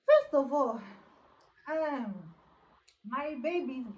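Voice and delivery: loud, high, indignant outburst; big, punchy "hold on" energy; emphatic objection. Real, not acted. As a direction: cut in loudly to object. Exclaims objection to viewers mid-story.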